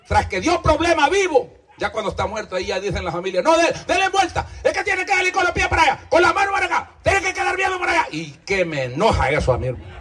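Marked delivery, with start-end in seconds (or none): 3.4-8.1 high, fast, bossy relative giving orders; mock fussy relative. Preacher mimics the family fussing over the body, high mock bossiness. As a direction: fuss over the corpse like a bossy aunt.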